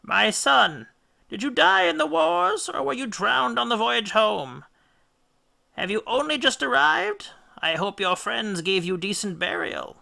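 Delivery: high, thin ghostly mother's voice; mournful mother. A narrator's reading. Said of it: "She greeted him mournfully".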